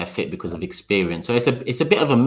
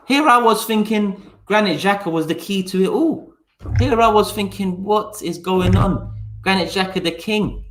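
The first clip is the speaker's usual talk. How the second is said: high-pitched mock amazement; hammed-up sarcastic marvelling; sarcastic awe; 11 semitones higher than usual. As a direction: feign being stunned, voice pitched way up. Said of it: "'cause he knows my sarcasm".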